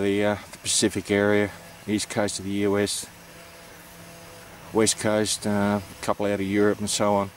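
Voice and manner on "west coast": ordinary delivery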